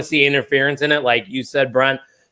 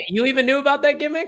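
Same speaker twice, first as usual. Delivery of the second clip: surprised; 13 semitones higher, faster than usual. Real, not acted. Host amazed at co-host.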